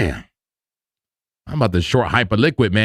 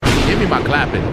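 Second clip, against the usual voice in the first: ordinary talk, nothing marked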